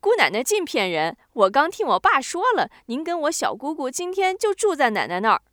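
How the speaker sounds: put-on a clever kid calling out a fib, excited; little girl voice. A narrator's reading. Little girl catches her great-aunt teasing, high and loud.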